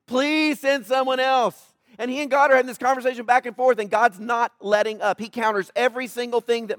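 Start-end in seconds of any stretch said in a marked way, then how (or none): 0.1-1.7 slow, drawn-out plea; begging. Retold or imitated. Preacher voicing Moses begging God, slow drawn-out plea. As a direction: plead it, stretching the words out. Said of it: "but he's begging God".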